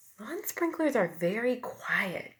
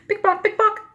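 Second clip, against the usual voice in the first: high, louder, hurried pick pock; running heels; 9 dB louder than usual. A narrator's reading. Teacher imitates the heels as the lady runs, higher and louder. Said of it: "The lady starts to run".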